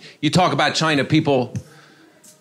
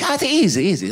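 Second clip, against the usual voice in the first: higher, teasing echo of easy; mock masseuse repeat; 4 semitones higher than usual. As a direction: echo his plea back at him. Masseuse mocking his plea.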